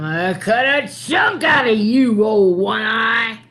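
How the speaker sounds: shrieking witch threat, high and loud; cackling witch vowing to cut him; witch's threat. Acted. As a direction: snarl it out like a mad old witch.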